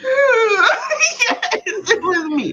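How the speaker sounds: high, laughing, crying-toned yes; laughing confession. Retold or imitated. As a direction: squeal out the confession through laughter. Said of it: "I was like".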